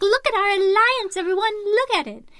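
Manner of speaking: high, loud showing-off call; mock proud announcement. Teasing her friends, a high proud call to everyone. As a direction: parade the alliance to the whole town. Announcing alliance while skipping through town.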